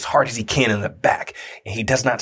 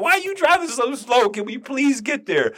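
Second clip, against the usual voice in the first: high-pitched exasperated pleading; impatient inner plea; 13 semitones higher than usual. Retold or imitated. Man in too much pain silently begs his wife, high impatient plea. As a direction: mock-desperate begging, much higher than usual. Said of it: "I was thinking the same thing".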